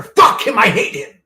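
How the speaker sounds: loud, high furious outburst; angry shout. Real, not acted. Ranting on stream, shouting his hatred out loud. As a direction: yell it out in rage.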